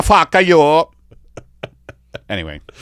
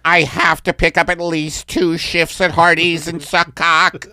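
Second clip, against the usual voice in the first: higher, louder pleading alien; alien worker voice; 6 semitones higher, 3 dB louder than usual. Pretend. Alien worker pleading with his Supreme Leader, higher and louder. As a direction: beg the leader in a silly alien voice. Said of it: "this is the Italian alien talking to his leader".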